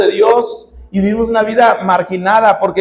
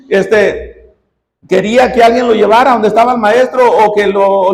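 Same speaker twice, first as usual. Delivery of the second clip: ordinary talk, nothing marked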